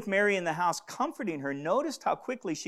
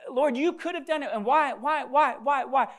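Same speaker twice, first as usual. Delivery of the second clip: raised, louder mock protest to the Lord; imitated pleading lament; 9 semitones higher, 6 dB louder than usual. Preacher voicing Mary and Martha's complaint, pleading. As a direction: press each why, high and insistent. Voices the sisters' anguished questioning.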